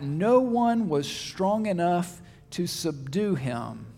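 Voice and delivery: each word slowed and weighed, no one stressed; slow, weighty reading. Shown as a demonstration. Preacher rereading the key verse to his congregation, slow and deliberate. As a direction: let it land slowly, word by word. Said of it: "the more notable comment that's made".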